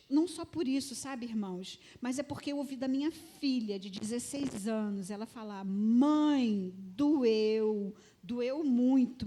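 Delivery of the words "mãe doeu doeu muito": loud, drawn-out heartfelt declaration; emphatic, slow outpouring; anguished retold confession. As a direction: lean hard and long on each word, imitating a hurting daughter. Daughter's heartfelt confession, retold with force.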